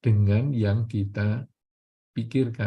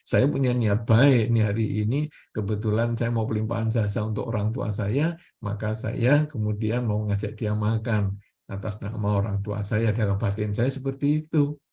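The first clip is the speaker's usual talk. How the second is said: ordinary talk, nothing marked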